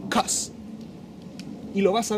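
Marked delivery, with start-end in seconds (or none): 0.1-0.5 CAUSE as a clipped-off because, "kos"; contracted form. Shown as a demonstration. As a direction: say just CAUSE, one syllable "kos". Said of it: "lo vas a escuchar así".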